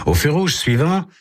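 ordinary delivery

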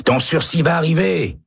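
high called-out reassurance; shout. A narrator's reading. Prisoner shouting reassurance to Baca. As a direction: shout hope down the corridor. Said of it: "crie Jorgensen".

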